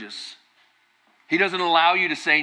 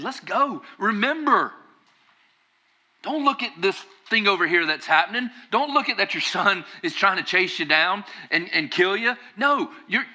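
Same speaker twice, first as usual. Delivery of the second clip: higher-pitched pep talk to himself; David; 6 semitones higher than usual. Acted. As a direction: coach yourself urgently in the mirror. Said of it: "He's looking at himself and saying".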